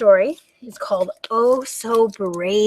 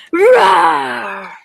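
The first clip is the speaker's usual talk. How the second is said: loud, high, long dragon roar; rolled play roar, falling away; monster-scaring roar; 8 semitones higher, 7 dB louder than usual. Pretend. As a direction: roar big to scare the monster. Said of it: "so we can all roar and scare the monster".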